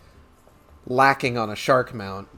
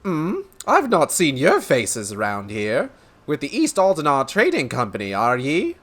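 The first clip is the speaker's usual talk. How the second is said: high, lilting put-on brogue; jovial merchant; 4 semitones higher, 4 dB louder than usual. Merchant greeting strangers from a trading company, jovial brogue. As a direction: play a hearty merchant with a lilt.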